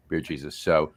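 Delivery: ordinary talk, nothing marked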